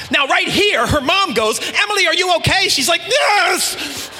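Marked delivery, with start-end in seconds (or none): 1.6-2.7 higher-pitched calling-out mom; voiced worried mom. Voicing a concerned mom. 3.1-4.1 high, strained yelled reply; mock exasperated yell. Girl answering her mom.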